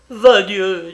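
loud, high burst of swearing; butcher's exploding oath; angry oath. A narrator's reading. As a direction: burst out with a furious curse. Butcher swears while being held down.

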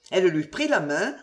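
ordinary delivery

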